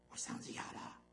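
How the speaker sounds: imitating a voice gone hoarse, near breathless; soft airy croak of a hoarse man. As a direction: rasp it out like a preacher who lost his voice. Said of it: "一听就知道他嗓子哑了".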